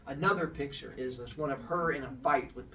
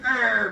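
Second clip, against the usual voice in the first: higher, louder mock growl; stink-eye growl; 7 semitones higher, 10 dB louder than usual. Storyteller mimics weavers glaring at each other, stink-eye growl. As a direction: growl at a rival across the loom. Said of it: "the stink eye the entire time".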